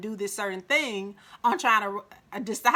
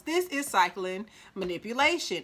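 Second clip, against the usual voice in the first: normal talking voice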